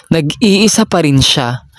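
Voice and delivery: ordinary delivery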